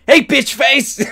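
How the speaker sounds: high, loud yell with a laugh in it; laughing shout. Pretend. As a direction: bellow it at the phone, giggling. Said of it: "And I'm like".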